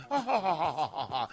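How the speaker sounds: fine woman's laugh. Acted. Radio host mimicking how fine women laugh, mock head-back laugh. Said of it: "your head go back like".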